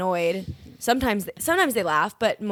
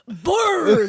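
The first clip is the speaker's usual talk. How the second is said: loud, high nonsense call, falling; random silly sound; 6 semitones higher, 8 dB louder than usual. Pretend. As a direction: blurt a loud high noise. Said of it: "I'll make a sound".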